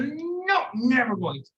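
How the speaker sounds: high, flat-out refusal; stubborn high no. Acted. Son refuses his family's plea to forgive, high and stubborn. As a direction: dig in and refuse.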